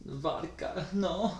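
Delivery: put-on hammy Russian-accented question; mock Russian accent. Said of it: "didn't sound like my Russian accent".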